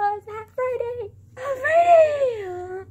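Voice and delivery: real joyful crying yell; tearful excited shout. Real, not acted. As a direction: cry it out in happy disbelief.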